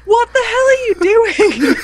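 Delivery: very high, incredulous mock outcry; bewildered outburst. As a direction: exclaim in hammed-up disbelief at a friend's noises. Retold bewildered outburst on Skype.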